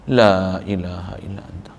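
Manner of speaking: slow reverent whisper; whispered dhikr. Acted. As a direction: breathe the words in devotion. Quiet dhikr after praise.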